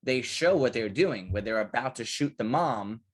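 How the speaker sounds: normal talking voice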